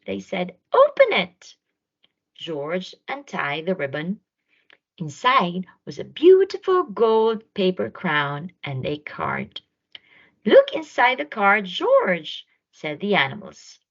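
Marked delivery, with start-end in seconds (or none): 0.7-1.6 very high, loud eager call; bursting with anticipation; excited animals. A narrator's reading. The animals urge George to open the present, high and loud. 10.4-12.5 higher, louder coaxing; excited friends pushing him on; eager animals. A narrator's reading. The animals tell George to read the card, eager.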